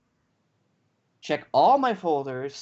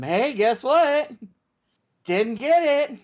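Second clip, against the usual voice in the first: much higher, slow, mock-cheery sing-song; sweetly sarcastic, pitched way up; fake-bright voice that means annoyed; sarcastic reply; 10 semitones higher, 3 dB louder, slower than usual. Sarcastic reply to customer service.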